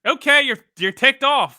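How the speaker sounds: high, loud, fed-up acknowledgement; loud, dismissive comeback; exasperated retort. Real, not acted. Sarcastic retort to absent coach.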